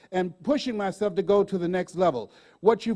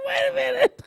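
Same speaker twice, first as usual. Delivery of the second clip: laughing; 4 dB louder than usual. Real, not acted. Laughing at her medications.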